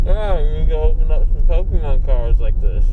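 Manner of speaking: muffled, mouth stuffed with gauze; towels in mouth. Real, not acted. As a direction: speak around towels in your mouth. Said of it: "'cause I got towels in my mouth".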